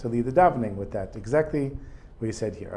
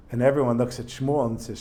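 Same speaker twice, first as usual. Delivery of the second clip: ordinary talk, nothing marked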